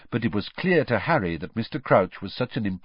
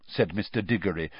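ordinary delivery

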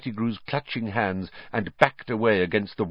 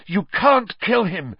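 high, urgent plea; winded, raised-pitch protest; breathless; 11 semitones higher, 4 dB louder than usual. A narrator's reading. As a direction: blurt it breathlessly. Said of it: "he said breathlessly".

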